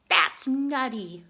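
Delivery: loud, slow goofy squirrel; hammed-up squirrel punchline. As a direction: drag out the joke playfully.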